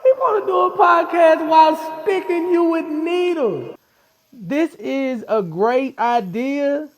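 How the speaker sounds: high, loud joking complaint; hammed-up grumbling at the tattoo artist, slowing on the last words; mock outrage. Real, not acted. Guest teasing the host about the needles, high mock outrage.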